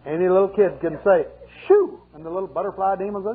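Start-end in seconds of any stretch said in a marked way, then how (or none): 1.7-2.0 playful shoo. Retold or imitated. Child shooing butterflies away.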